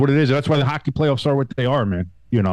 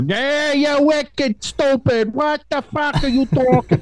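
hammed-up Boston accent, much higher and drawn out; a clumsy mock Boston fan yelling with a laugh in it; overdone Boston accent, breaking into laughter; bad Boston accent; slower than usual. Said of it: "I'm bad at accents".

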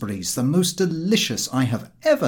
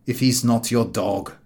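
a puzzled village innkeeper asking; landlord; 4 dB louder than usual. Acted. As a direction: landlord asking why the dog follows him. Landlord voiced in story.

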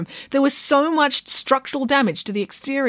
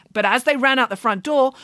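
ordinary delivery, nothing marked

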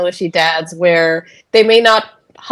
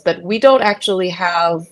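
usual speaking voice

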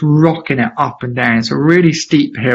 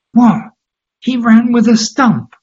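stunned disbelief; 9 semitones higher than usual. Retold or imitated. Tearful dad hearing about Terry Fox, astonished. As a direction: gasp it out in amazement.

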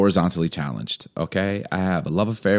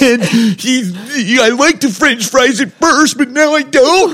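hammed-up crying; 8 dB louder than usual. Bullied freshman telling his dad, hammed-up crying. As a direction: bawl like a bullied kid, exaggerated. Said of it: "I just bust out crying".

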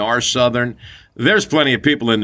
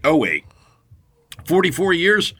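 ordinary talk

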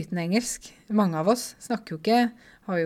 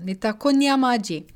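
raised, louder voice repeating the shouted blunder; loud call; 5 semitones higher, 5 dB louder than usual. Retold or imitated. Storyteller re-enacts her Swahili blunder for listeners, loud call. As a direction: call out the Swahili proudly and loudly. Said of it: "høyt på et marked".

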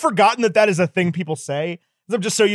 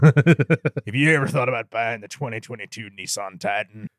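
low cowboy pitchman; Sam Elliott; 6 semitones lower than usual. Acted. Host pitching a truck in jest, Sam Elliott impression. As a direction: sell it in a low, folksy ad voice. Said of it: "people doing the Sam Elliott impression".

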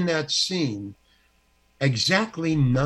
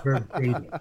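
lower, pointed single word, lightly mocking; imitated script lady; 4 semitones lower than usual. Actor mimicking the script lady's correction, mildly pointed. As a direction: mimic her insistence on the exact word. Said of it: "And she said it like that".

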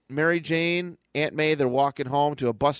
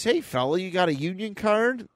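higher-pitched costumed parade rabbit, mild; a hint of a rabbit character, pitched up; lightly voiced rabbit; 7 semitones higher than usual. Parade rabbit challenging a newcomer, lightly voiced and higher. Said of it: "the rabbit goes".

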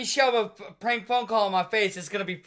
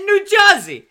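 a hint of a Boston accent, higher and louder; 10 semitones higher, 9 dB louder, slower than usual. Caller answering where he's from, in a Boston accent. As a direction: blurt it in a loose Boston accent. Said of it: "channeling fucking Boston accent Matt Damon".

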